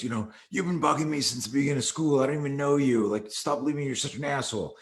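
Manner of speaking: imitated teenage indignation; retold angry confrontation. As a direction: act out fed-up protest at a bully. Retold student confronting his bully.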